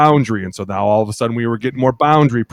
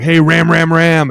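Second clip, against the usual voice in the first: loud, higher call across the line; 7 semitones higher, 7 dB louder, slower than usual. Retold or imitated. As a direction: shout the name to a teammate. Player calling out at line.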